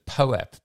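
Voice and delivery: poap said as one word, "po-ap", slow and clear; spelling it out for the AI. Shown as a demonstration. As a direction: say poap, "po-ap", carefully for the transcriber. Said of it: "Otter dot A I does not understand the word poap".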